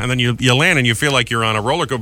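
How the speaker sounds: ordinary delivery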